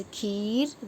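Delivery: खीर with a long, drawn-out ee; teacher's slow खीर, vowel held; long ee demonstration. Shown as a demonstration. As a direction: stretch the ई in खीर slowly. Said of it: "देखो इसका उच्चारण भी अलग हो रहा है".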